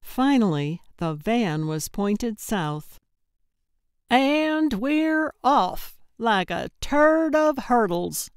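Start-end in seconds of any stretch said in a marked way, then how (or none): 4.1-8.3 high, drawn-out triumphant announcement; gleeful send-off, slow and ringing. A narrator's reading. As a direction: proclaim it grandly, stretching the opening. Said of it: "Gertrude said triumphantly".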